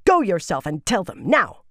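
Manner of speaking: loud order, peaking on "now"; snapped command. A narrator's reading. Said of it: "Shanella snapped".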